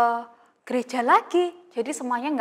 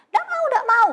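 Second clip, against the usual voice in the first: much higher, louder bratty whine; whiny child refusing; 13 semitones higher, 5 dB louder than usual. Retold or imitated. Child refusing a small chore, high loud tantrum whine. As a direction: play a kid throwing a tantrum over chores. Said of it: "terus marah-marah".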